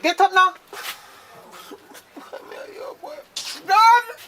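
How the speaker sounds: high, loud yell; furious yell to get up; angry shout. Acted. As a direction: bark the order at him. Scolding sleeper to get up.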